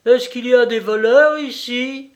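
high, loud mocking call; loud girlish challenge, rising in pitch; playful shout. A narrator's reading. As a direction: shout it teasingly into the dark. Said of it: "me cria l'aîné en riant".

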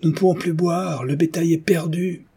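high, desperate villager's cry; crowd outcry. A narrator's reading. Villagers lamenting water and cattle, crying out. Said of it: "cria-t-on".

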